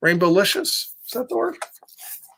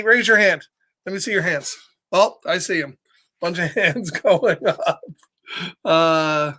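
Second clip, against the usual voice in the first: ordinary delivery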